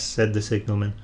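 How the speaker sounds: ordinary delivery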